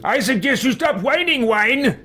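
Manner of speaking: high, sneering mock of her warning; mocking echo. Real, not acted. Player taunting the old mage, high mocking echo. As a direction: parrot her threat back to belittle it.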